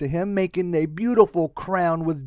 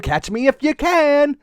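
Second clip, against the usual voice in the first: teasing lizard; 8 semitones higher, 3 dB louder than usual. Acted. Lizard taunting Marisol.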